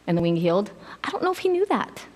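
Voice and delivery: usual speaking voice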